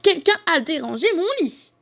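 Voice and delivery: high-pitched, vexed outburst; worked-up storybook mama bear; angry mama bear. A narrator's reading. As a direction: snap it as an increasingly cross mother bear. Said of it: "de plus en plus fâchée, s'énerve".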